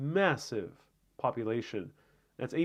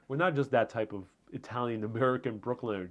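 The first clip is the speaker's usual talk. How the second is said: usual speaking voice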